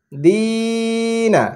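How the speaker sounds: কিলা with both vowels drawn long and slow; long vowels stretched. Shown as a demonstration. Teacher showing pupils the long vowels, stretched. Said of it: "টানতে হচ্ছে".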